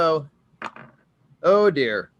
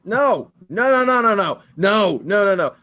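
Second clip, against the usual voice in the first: mock protest; 5 semitones higher, 6 dB louder than usual. Player panicking at being thrown out.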